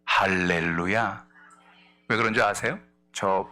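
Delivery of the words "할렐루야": slow, low, drawn-out hallelujah. Real, not acted. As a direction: savor the praise slowly. Preacher exclaims praise. (낮고 길게 끄는 할렐루야)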